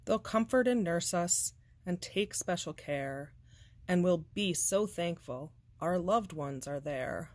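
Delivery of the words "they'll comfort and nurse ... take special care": plain reading voice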